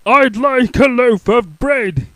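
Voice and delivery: loud, slow high boom. Acted. Mister Noisy ordering bread, slow boom. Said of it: "he boomed".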